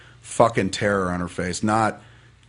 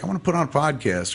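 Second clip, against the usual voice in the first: higher-pitched, lightly acted enthusiasm; eager dreamer; 6 semitones higher than usual. Dreamer announcing a new whim, lightly acted eagerness.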